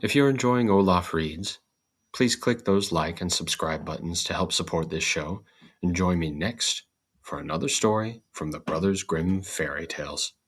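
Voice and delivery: ordinary delivery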